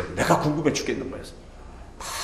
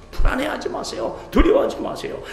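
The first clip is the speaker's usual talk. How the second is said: soaring exhortation; 3 dB louder than usual. Acted.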